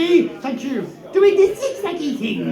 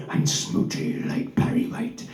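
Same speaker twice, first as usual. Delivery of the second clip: whispered aside; 4 semitones lower, 8 dB quieter than usual. Acted.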